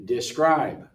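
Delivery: deSCRIBE, weight on the second syllable; second-syllable stress. Shown as a demonstration. Teacher models sorting by stress, second syllable of describe stressed. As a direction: lean into the last syllable of describe. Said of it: "first syllable, second syllable".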